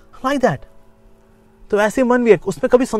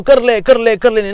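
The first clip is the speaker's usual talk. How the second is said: louder, pushy urging; urging mind; 5 semitones higher, 5 dB louder, slower than usual. Acted. Restless mind urging the self to act, pushy. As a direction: egg yourself on.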